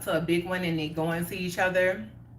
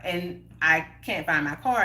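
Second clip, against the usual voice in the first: normal talking voice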